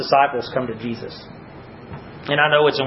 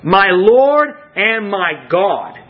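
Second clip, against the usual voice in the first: very slow, raised proclamation; exalted declaration; 5 semitones higher, 3 dB louder, much slower than usual. Retold or imitated. Preacher showing how you address Christ, exalted and slow. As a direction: draw out each title with reverent weight. Repeats Thomas's confession as model address.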